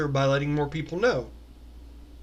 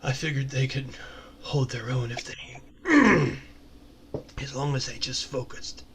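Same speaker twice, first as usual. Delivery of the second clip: low, subdued, halting character voice; Drayden, reserved proud mentor; 4 semitones lower, 5 dB quieter than usual. Acted. Drayden tells the newcomer about these folks, low and reserved. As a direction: speak low, thinking between phrases.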